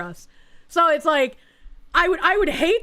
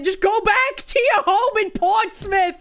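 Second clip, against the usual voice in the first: hammed-up Chinese accent, higher, slow and shouted through laughter; laughing while imitating her Chinese accent, loud; mock Chinese accent; 7 semitones higher, 3 dB louder, slower than usual. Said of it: "with her thick-ass accent saying".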